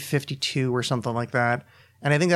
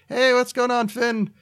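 high, louder background-character greeting; up-pitched cartoon voice; 10 semitones higher, 3 dB louder, slower than usual. Imagined Adventure Time extra greeting Finn, high voice. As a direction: chirp it like a passer-by waving. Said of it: "when I get all weird and up-pitched".

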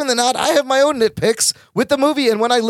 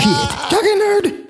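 mock creepy caller voice; 9 semitones higher, much slower than usual. Echoing the anonymous caller.

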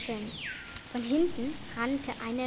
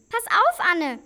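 high, loud urgent alarm; shouted warning; 7 semitones higher, 12 dB louder than usual. Acted. Child warning sister of flying fruit, shouted. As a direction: yell a sudden warning.

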